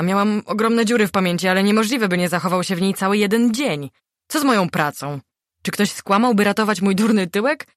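ordinary delivery

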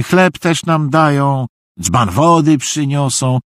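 high, slow, drawn-out listing; delighted high-pitched fool; praising simpleton. A narrator's reading. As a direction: extol each item in a high, stretched voice. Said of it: "pochwalał Szwajk Nowe Czasy".

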